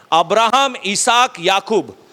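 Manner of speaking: ordinary talk, nothing marked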